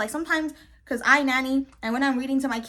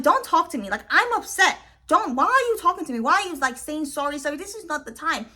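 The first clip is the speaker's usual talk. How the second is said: higher, louder acted irritation; exasperated telling-off, pitched up; upset; 5 semitones higher, 3 dB louder than usual. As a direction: push back at someone who keeps talking to you. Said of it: "If I'm upset in general".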